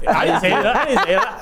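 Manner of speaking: high, laughing mock protest of innocence; mock innocent denial. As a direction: play the teammate denying it, half laughing. Teammate denies getting him in trouble.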